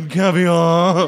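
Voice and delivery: posh butler drawl. Retold or imitated. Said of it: "I'm surprised he wasn't like".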